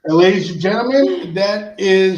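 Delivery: loud, fast showman wrap-up; booming host sign-off; announcer call. Acted. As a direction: close the show like a ringmaster. Host closing the clip.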